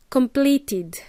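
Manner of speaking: "completed" with a clear final "id" syllable; id ending. Shown as a demonstration. Teacher giving an example, "completed" ending in id. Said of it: "pronounce them as id".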